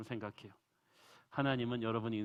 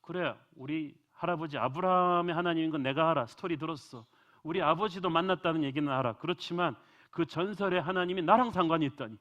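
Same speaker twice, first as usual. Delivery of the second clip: louder, incredulous exclamations; shocked Jacob; 4 semitones higher, 6 dB louder than usual. Acted. Jacob reacting to God's words, voiced louder and incredulous. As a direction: play Jacob stunned that this God is his own. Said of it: "야곱이 충격을 받았어요".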